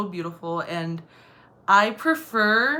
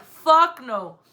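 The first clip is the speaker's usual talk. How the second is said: punchy, louder retold refusal; emphatic refusal; 2 semitones higher, 6 dB louder than usual. Woman repeating what she said to the stranger, emphatic. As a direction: punch out the swear. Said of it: "I actually said".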